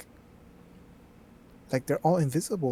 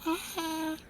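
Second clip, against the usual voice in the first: much higher, thin squeaky voice; high squeak try; 4 dB quieter, much slower than usual. Shown as a demonstration. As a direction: squeeze the voice up high. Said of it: "I can talk".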